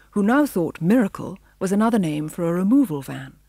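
usual speaking voice

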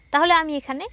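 ordinary delivery

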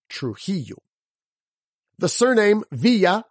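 normal talking voice

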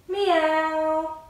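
put-on high playful meow for the kids; cat meow. Shown as a demonstration. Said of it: "make the cat noise".

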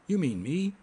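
angry high-pitched retort. A narrator's reading. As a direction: snap it back as an accused man. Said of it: "asked Jasper Cole angrily".

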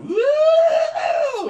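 high, loud laughing whoop; mock whoop. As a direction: hoot like a crowd that saw an ankle. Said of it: "and they're like".

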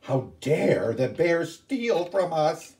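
slow, high mock outrage; hammed-up indignant ghost; furious ghost. Ghost sister scolding the bear, mock furious. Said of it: "They were both furious".

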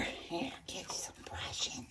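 soft whisper to the pet. Real, not acted. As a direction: breathe it to the possum. Grooming the pet possum.

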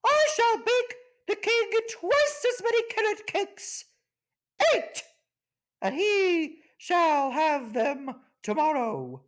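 very high squawky bird voice; grand macaw. Acted. The macaw outbids the others, very high and grand. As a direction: announce it like a showy parrot.

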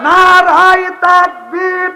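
high, loud, slow rallying cry; takbir call. Real, not acted. Preacher leading the crowd's takbir chant.

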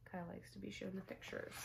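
soft whispered aside. Real, not acted. Reader aside to Kai, soft whisper.

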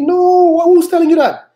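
loud, high-pitched exclamation; exasperated rebuke. Real, not acted. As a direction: burst out in disbelief at them. Rebukes the imagined praying believer.

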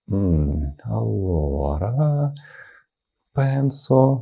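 slow, drawn-out thinking in Italian; stalling for time. Shown as a demonstration. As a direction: stretch it out as if searching for words. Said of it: "розтягуємо час". (розтягуючи час)

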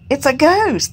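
high terrified cry; frightened cry. A narrator's reading. Disciples seeing Jesus on the lake, terrified cry. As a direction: cry it out in fear. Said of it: "cried out in fear".

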